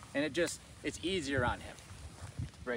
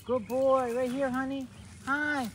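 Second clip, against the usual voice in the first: high and louder, bubbly; excited dog praise; 13 semitones higher, 5 dB louder, slower than usual. Real, not acted. Owner excitedly praises her puppy, high and loud. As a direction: cheer him on like he won a prize.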